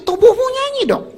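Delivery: energetic urging. Acted. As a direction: voice leaps high on tubuhmu nyanyi dong. Preacher urges singing with body. (desakan bersemangat)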